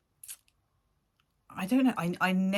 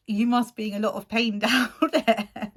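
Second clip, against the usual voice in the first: laughing quote; 7 semitones higher, 7 dB louder than usual. Retold or imitated. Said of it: "and I'm like".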